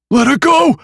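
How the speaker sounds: high loud shout. A narrator's reading. Nick trying to save Cassie, shouting. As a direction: yell it to stop her. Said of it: "Nick shouted".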